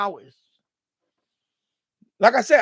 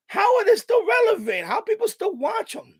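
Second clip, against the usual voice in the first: incredulous; 11 semitones higher than usual. Retold or imitated. Quoting incredulous commenters.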